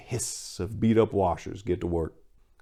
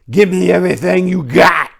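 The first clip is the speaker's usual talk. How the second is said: loud, high furious yell; angry shout; 9 semitones higher, 9 dB louder than usual. A narrator's reading. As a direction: bark it at him, fed up. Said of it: "his face turned angry".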